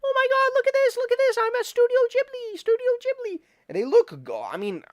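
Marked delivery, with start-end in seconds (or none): 0.0-3.4 very high, excited squeal; squealing fan voice. Retold or imitated. Excited people showing off their AI picture, very high squeal. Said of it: "They're like".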